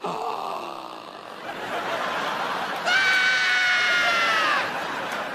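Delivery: huge high mock gasp. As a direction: gasp in exaggerated wonder. Woman sees him, gasps.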